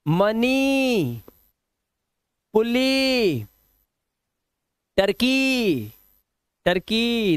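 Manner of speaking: मनी, पुली, टर्की held high on a long "ee"; long vowel sound. Shown as a demonstration. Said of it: "long vowel sound".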